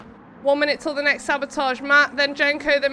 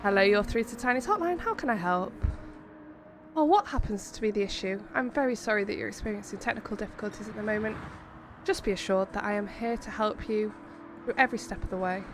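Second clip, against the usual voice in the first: low, soft customer service voice; polite phone helpline operator; call centre voice; 4 semitones lower, 6 dB quieter, faster than usual. Pretend. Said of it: "you like my call centre voice?".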